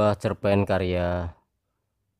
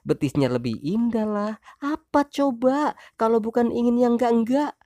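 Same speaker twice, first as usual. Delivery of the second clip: accusing wife. Acted. Wife accusing husband of comparing women, high voice. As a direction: lay out the charges as the hurt wife.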